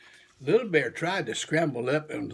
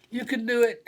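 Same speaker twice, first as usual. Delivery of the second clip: smiling encouragement; 9 semitones higher, 4 dB louder, faster than usual. A narrator's reading. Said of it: "he smiled".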